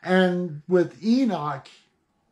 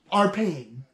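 ordinary delivery

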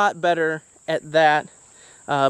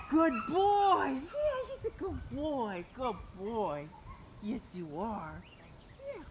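high soft dog praise; 4 semitones higher, 17 dB quieter than usual. Real, not acted. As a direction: coo softly at the dog. Cooing to puppy, far off.

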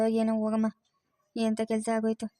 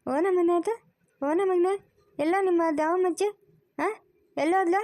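put-on playful baby talk; 6 semitones higher than usual. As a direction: tease a toddler in a raised, playful voice. Teasing a small child.

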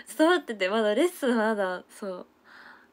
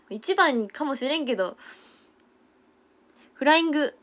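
teasing punchline; 5 semitones higher, 3 dB louder than usual. Retold or imitated.

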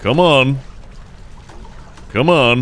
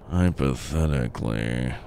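hushed repeat; 10 semitones lower, 10 dB quieter than usual. Real, not acted. Player doubting his plan should work, hushed low murmur. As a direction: say it softly, sinking low.

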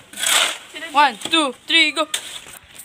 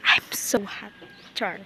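whispering; 7 semitones lower, 8 dB quieter than usual. Real, not acted. Whispered aside in the heat.

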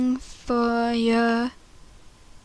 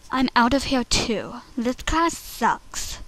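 muted disgust; 6 dB quieter than usual. Acted. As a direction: grumble it softly on the way out. Audition take, muted walkout.

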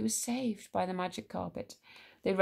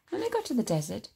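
usual speaking voice

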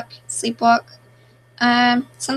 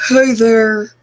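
deepest voice; 7 dB louder than usual. Shown as a demonstration. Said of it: "do the deepest voice you can".

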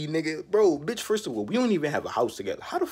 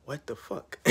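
whispered aside; 10 dB quieter, slower than usual. Real, not acted.

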